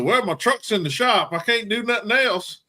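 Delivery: high-pitched mock excuse-making; retold protest. Stopped hunter explains himself to game wardens, protesting. Acts out hunter pleading with officers.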